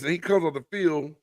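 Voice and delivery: ordinary talk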